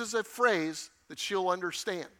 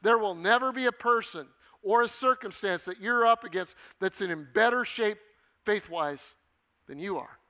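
ordinary delivery

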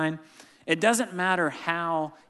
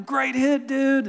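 cheering praise; 11 semitones higher, 5 dB louder, slower than usual. Real, not acted. Cheering son on field.

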